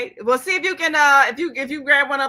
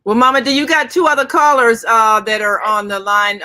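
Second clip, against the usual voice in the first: raised voice, calling across the studio; loud call; 9 dB louder than usual. Real, not acted. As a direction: call out to get her attention. Host calls out to co-host.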